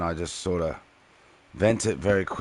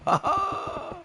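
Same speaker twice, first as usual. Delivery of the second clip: squeaky laugh. Real, not acted. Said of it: "not gonna sound like a kid".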